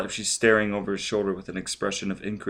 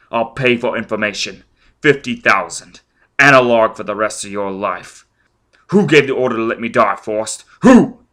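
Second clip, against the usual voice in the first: louder, pressing offer ending in a yell; angry demand; 2 semitones higher, 10 dB louder than usual. Acted. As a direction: push the bribe, then explode. Foyle bribing, then shouting.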